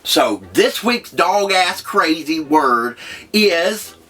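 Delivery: high, slow, hyped announcer read; show host intro. Acted. Host opening the first episode, hyped announcer voice. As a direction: pump up the audience like a show host.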